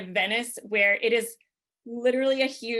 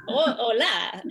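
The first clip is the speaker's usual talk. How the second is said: put-on Italian-accented Spanish; 4 dB louder, slower than usual. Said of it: "Spanish in an Italian accent".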